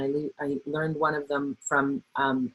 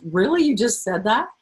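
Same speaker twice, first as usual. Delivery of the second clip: pointed disbelief; 5 semitones higher, 4 dB louder than usual. Shown as a demonstration. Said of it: "that little eyebrow thing".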